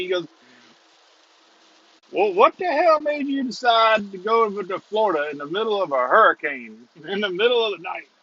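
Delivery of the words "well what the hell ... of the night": imitation Louisiana policeman, amused and incredulous; Louisiana accent. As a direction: mimic a baffled Louisiana cop, breaking into laughter. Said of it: "this is in your best Louisiana accent".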